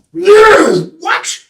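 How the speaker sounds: loud, high yelp of surprise; outraged disbelief. Acted. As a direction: burst out in disbelief. Seeker astonished by the sign.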